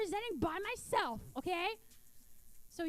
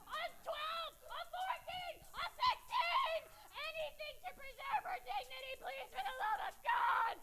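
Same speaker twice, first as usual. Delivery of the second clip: mock shouting mom; 11 semitones higher, 4 dB quieter than usual. Mom yelling across the store.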